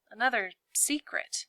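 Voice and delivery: plain reading voice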